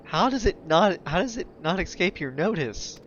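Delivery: high, disbelieving question; can't believe what she heard; incredulous. Real, not acted. Mocks the NPC's claim.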